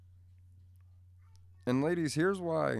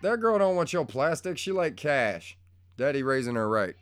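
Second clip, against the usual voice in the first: loud, higher teasing call; louder teasing call back to his daughter; 5 semitones higher, 7 dB louder than usual. Real, not acted. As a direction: shout the tease across the house.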